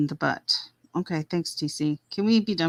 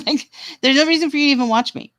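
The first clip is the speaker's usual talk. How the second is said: much higher and laughing through it; 9 semitones higher, faster than usual. Real, not acted. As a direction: repeat it with a laugh. Repeats line with a laugh.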